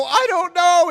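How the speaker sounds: loud, high-pitched wail; panicked protest. Retold or imitated. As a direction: blurt it out in comic panic. Panicked reply to scolding.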